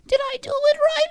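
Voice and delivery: put-on high falsetto old-lady voice; old woman. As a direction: imitate an old woman in a thin, very high pitch. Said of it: "he sounds like an old woman".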